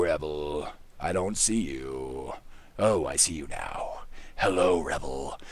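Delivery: hushed low greeting; quiet low voice. Acted. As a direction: murmur low to the pet.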